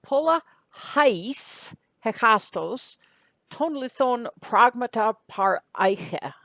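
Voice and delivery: slow, careful reading of the Greek sentence. Shown as a demonstration. Teacher reads a Greek sentence aloud to the class, slow and deliberate. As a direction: lay out each Greek word slowly and clearly. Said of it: "here's a sentence".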